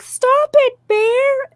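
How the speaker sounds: high, whiny scolding of a bear; mock an irritating girl telling a bear off; annoying girl voice. Mocking the annoying girl in the bear video, high and whiny. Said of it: "she's super annoying".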